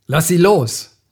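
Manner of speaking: Michael calls out to the thug; shouted command. A narrator's reading. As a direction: yell "los" down hard. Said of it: "rief Michael".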